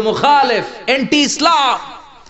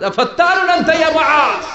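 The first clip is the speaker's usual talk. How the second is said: higher, louder retold rebuke; scolding shout; 10 semitones higher, 4 dB louder than usual. Prophet scolds Muaz for long prayers, imitated loud rebuke. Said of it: "ধমক দিয়ে বললেন".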